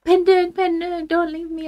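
hammed-up tearful begging; mock crying plea. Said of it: "They were all crying".

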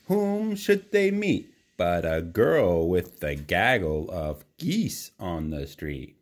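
usual speaking voice

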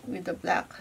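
ordinary delivery

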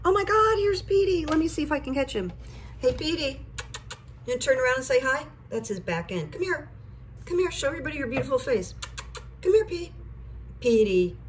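high, sing-song coaxing voice; pet talk. Real, not acted. Calling Petey to show his face, high coaxing voice. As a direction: coax the cat into view, high and sweet.

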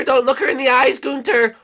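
ordinary delivery

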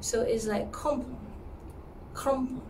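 crampon nasal om with light R. Shown as a demonstration. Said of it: "C O M sound with ... R".

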